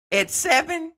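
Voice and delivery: high-pitched disbelief; incredulous. Real, not acted. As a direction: say it as if you can't believe the age. Shocked at the age mentioned.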